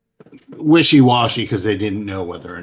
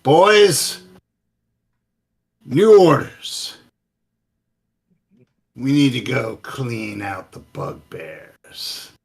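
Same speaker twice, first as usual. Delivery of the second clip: high, slow commanding call to his troops; gnoll leader voice; 4 semitones higher, 4 dB louder, slower than usual. Acted. Gnoll leader rallying his boys, high and slow. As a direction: bark new orders like a gnoll boss. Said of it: "and he goes".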